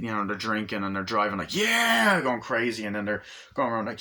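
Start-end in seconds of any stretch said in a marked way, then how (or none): 1.6-2.2 high, loud rowdy yell; wild whoop. Acted. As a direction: holler it like a gang gone crazy. Drunken gang going crazy.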